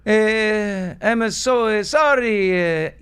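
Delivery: high, loud and slow Pope voice; apologetic Pope. Acted. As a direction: gently correct the fans as the Pope. Pope answers the crowd.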